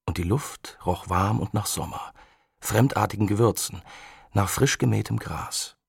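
ordinary delivery

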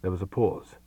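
plain reading voice

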